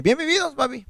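squeaky high, teasing; high playful voice. Acted. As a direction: pitch it way up like a joke. Playful toast to his own age.

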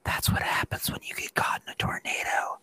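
low-voiced, unvoiced grandmotherly whisper; hushed whisper straight into a child's ear. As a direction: lean in and whisper it like a loving warning. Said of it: "whispers in my ear".

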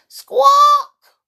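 shrill high bird shriek; pigeon squawk. Acted. Startled pigeon squawking loud and high. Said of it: "squawked the bird".

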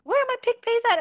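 high, loud mock shout; imitated shouting. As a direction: imitate a streamer shouting demands at followers. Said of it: "she started shouting".

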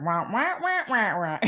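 wordless wah-wah drone, rising in pitch; Peanuts cartoon grown-up. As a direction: imitate meaningless muffled adult chatter. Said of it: "like from the Peanuts cartoon".

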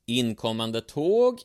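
drawn-out warning call from the locomotive. Acted. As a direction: call it out across the platform like a conductor. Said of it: "ropade rösten".

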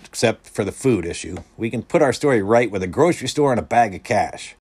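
mildly emphatic character line; announcing. A narrator's reading. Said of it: "Stephen announced".

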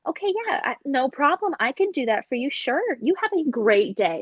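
high, bright-toned sweet service voice; chirpy fake politeness for a customer. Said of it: "my customer service voice".

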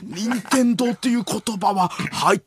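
theatrical searching for a name; 伊集院光. Acted. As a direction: pretend to rack your brain aloud. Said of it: "伊集院光さんが". (わざとらしく考え込む伊集院のものまね)

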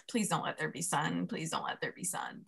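hushed, desperate little prayer; quiet pleading. Retold or imitated. Praying to herself on the trail for no sunshine, hushed pleading. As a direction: beg under your breath. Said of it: "And I'm just like".

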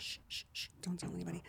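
very soft conspiratorial shushing; barely-there mock secretive whisper; playful whisper. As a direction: hush it right down, keeping a secret. Playing along with the secret.

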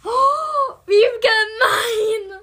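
high, slow fake wail; playful hurt sob, drawn out; mock crying. Mock hurt after blunt no. (gespielt weinerlich)

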